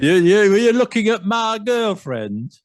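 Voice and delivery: put-on high, loud comic Yorkshire accent; exaggerated Yorkshire. Jealous pub local confronts a visitor, exaggerated Yorkshire accent. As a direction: challenge him in a hammed-up Yorkshire accent. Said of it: "they're just a comic effect".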